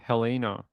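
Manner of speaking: Helena as heh-LEE-na; Swedish pronunciation. Shown as a demonstration. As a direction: stress the long LEE in Helena. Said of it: "in Sweden, they say".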